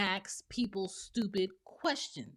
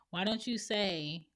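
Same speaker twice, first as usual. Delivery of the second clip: ordinary talk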